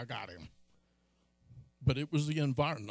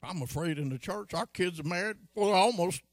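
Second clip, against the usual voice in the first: ordinary delivery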